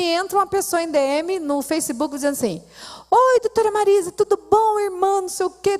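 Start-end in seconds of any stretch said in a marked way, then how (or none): 3.1-5.8 quoting a friendly man. Retold or imitated. Quoting the man's online message.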